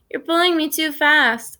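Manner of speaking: put-on whiny little-kid complaint; whining. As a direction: whine it, tugged along. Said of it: "Isaac whines".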